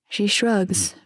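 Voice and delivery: usual speaking voice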